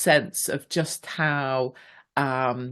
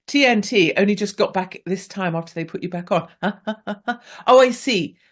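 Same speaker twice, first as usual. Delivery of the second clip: ordinary talk, nothing marked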